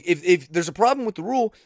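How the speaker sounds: ordinary talk, nothing marked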